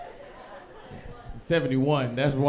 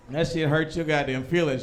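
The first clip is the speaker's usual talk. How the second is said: ordinary talk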